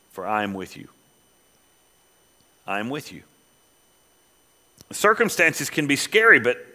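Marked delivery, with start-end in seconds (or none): 0.1-3.2 much softer and lower, gently; tender reassurance. Acted. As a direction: reassure a frightened listener softly. Quiet tender reassurance after pause.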